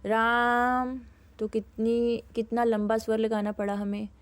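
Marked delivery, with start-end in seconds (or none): 0.1-1.0 राम called out long and loud, vowel stretched; calling from afar. Shown as a demonstration. Teacher demonstrating a long call across a distance. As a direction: call out to someone far away. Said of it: "दूर से आवाज़ लगाएंगे".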